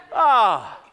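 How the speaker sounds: loud, high falling "ah"; exasperated cry. Real, not acted. Pastor exasperated at himself, loud falling cry. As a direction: let out a big comic wail that slides down. Comic outburst after the confession.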